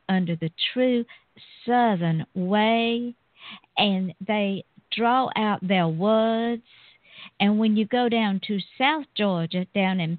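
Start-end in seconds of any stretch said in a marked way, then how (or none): 0.7-6.6 slow, stretched-out words; a slow Charleston drawl, mildly shown; drawn-out Southern talk. Shown as a demonstration. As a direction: draw out every word like Charleston. Describing Charleston's slow cultured speech.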